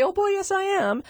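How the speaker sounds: a dog cheerfully refusing no; cheeky dog voice. Retold or imitated. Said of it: "And he'd say".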